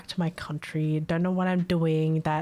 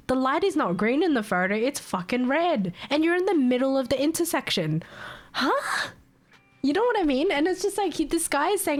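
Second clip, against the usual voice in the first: heated rant; 8 semitones higher than usual. Real, not acted. Ranting about the caller.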